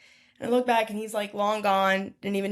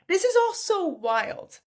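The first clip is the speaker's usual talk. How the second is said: higher and slower, marvelling; amazed; 9 semitones higher, slower than usual. Retold or imitated. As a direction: stretch it out in happy disbelief. Amazed at meeting people.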